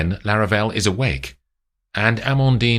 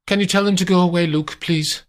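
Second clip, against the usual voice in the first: high, fast, impatient request; high voice, hurried and dismissive; impatient mistress; 11 semitones higher than usual. Acted. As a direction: brush the visitor off quickly. Amandine ordering the chauffeur.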